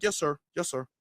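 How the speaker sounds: timid officer answering; meek submissive. Pretend. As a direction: shrink back and comply. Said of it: "They gonna be like".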